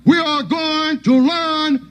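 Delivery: high, slow, deliberate drill sergeant; menacing sergeant. Retold or imitated. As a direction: savor every threat slowly. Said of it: "the sergeant would be bad".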